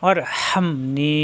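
slow, each syllable held apart; careful teacher's recitation; slow recitation demo. Shown as a demonstration. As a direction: say it slowly so the student hears every sound. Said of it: "এক আলিফ টান".

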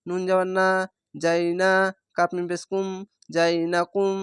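ordinary talk, nothing marked

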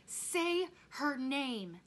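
very slow, high, loud demand; screamed demand. A narrator's reading. Rob to his father about his mother, screaming. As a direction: stretch each word into a scream. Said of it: "Rob screamed into the silence".

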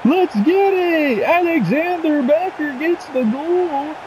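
very high, slow, drawn-out celebration; excited goal call. Real, not acted. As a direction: hold every word high like a commentator gone wild. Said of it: "Why I sound like that?".

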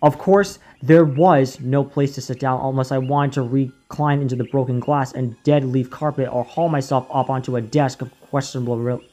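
usual speaking voice

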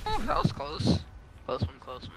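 usual speaking voice

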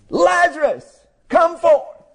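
high, commanding call to the dead; crying out. Acted. Preacher as Jesus calling Lazarus from the tomb, high cry. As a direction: cry it out far above speaking pitch. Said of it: "No, he cried".

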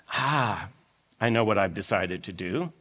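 low, soft scheming aside; hushed, low voice of a schemer; dawning idea. Acted. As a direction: think aloud quietly as a plan lands. Manager hits on a plan.

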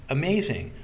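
high-pitched, delighted exclamation; amazed. A narrator's reading. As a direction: marvel at the coincidence. Girl amazed at the coincidence.

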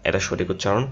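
ordinary talk, nothing marked

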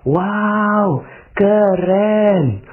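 high, savoured admiration; admiring wow. Shown as a demonstration. Preacher shows how to praise a corruptor, admiring. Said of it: "coba Anda ngomongnya gini".

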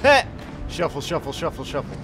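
ordinary delivery

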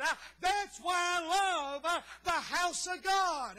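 loud, excited revival preaching; fervent preaching. Acted. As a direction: whip the congregation up. Preacher working up congregation.

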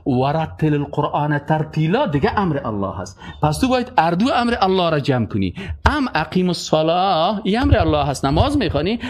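ordinary delivery

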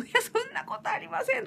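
high, hammed-up modest denial; mock modest refusal. Imitating someone refusing praise in a high, hammed-up voice. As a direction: act out brushing off a compliment. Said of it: "とか言って".

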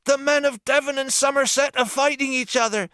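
put-on high, loud panicked boy's cry; a young boy shouting bad news; frantic boy calling out. A narrator's reading. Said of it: "he cried".

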